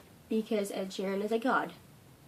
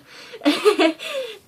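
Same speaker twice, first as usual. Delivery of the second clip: laugh; 9 semitones higher, 11 dB louder than usual. Real, not acted. Laughs after mock wail.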